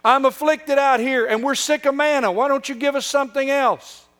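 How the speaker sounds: high, loud whiny complaint; mock the whining Israelites, high and loud. Preacher mocking Israel's whining to God, high loud whine. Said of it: "Whining and whining and whining".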